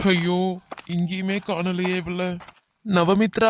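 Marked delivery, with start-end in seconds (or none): 2.9-3.5 drawn-out shout of a name; loud calling out. Acted. Searching for missing Navamitra, calling out loudly. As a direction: call out for someone lost. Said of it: "எவ்வளோ சத்தம் போட்டு கூப்பிடுறேன்".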